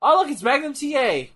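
light up at the sight of him; excited. Real, not acted. Excited spotting of wrestler.

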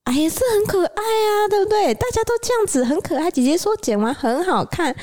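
high-pitched guilty sweet-talk; placating dad. Retold or imitated. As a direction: sheepishly talk the kid round.

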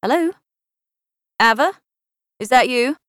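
bright, high forced cheer; cheery phone greeting to mother; determinedly cheery. A narrator's reading. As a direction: put on a cheery front. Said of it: "a determinedly cheery".

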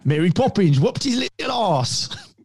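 high-pitched put-on southern English accent; hammed-up Mary Poppins cockney; mock southern English. Joking Mary Poppins whooped Luke, mock southern English accent. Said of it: "put any southern accent on".

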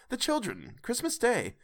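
husband calming his wife; gentle rebuke. A narrator's reading. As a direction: hush her kindly for the children. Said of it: "said Bob".

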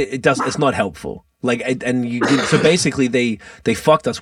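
ordinary talk, nothing marked

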